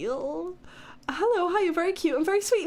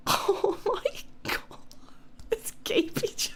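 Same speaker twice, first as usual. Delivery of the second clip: breathless whisper; 5 semitones higher, 5 dB quieter than usual. Real, not acted. Whispered embarrassed laugh.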